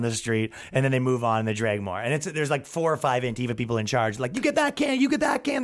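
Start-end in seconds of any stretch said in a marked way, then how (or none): 4.3-5.6 high commanding quarterback call. Retold or imitated. Imitating a protest leader directing like a quarterback. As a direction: bark orders like a quarterback. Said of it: "calling it like they're a football quarterback".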